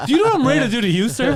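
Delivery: laughing mock threat, loud and high; mock tough-guy threat. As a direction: ham it up like an action hero, laughing.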